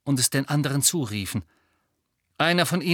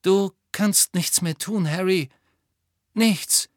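grieving firm; 5 semitones higher, slower than usual. Acted. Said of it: "Lupin ließ ihn nicht los".

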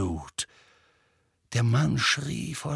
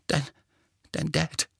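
halting, out of breath; gasping; 4 semitones higher, 3 dB quieter than usual. A narrator's reading. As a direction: struggle to get the words out between breaths. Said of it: "keuchte er". (keuchend, stockend)

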